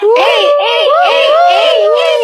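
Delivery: high-pitched rhythmic whooping; party hype. Acted. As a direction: chant it like hyping a party. Party cheering, ready to go. (masiglang hiyaw sa party)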